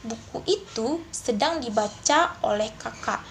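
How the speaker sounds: slow, with breaks after "itu" and "dibaca"; correct phrase breaks. Shown as a demonstration. Teacher shows students the correct way to split the sentence, slow, clear phrasing. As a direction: split it as "Buku itu / sedang dibaca / oleh kakak". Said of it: "ketika dipenggal menjadi".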